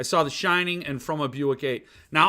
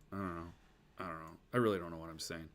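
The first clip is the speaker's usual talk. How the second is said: mumbling; 10 semitones lower, 13 dB quieter, much faster than usual. Real, not acted. Trails off into mumbling.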